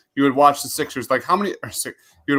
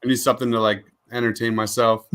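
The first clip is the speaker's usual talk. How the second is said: normal talking voice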